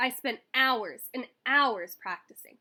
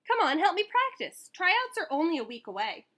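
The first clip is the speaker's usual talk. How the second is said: high, louder pleading; eager plea; 7 semitones higher, 5 dB louder than usual. A narrator's reading. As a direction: beg big brother eagerly. Boy begging brother to practice.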